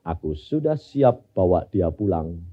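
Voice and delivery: voice of God. Acted. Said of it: "Tuhan bilang apa?".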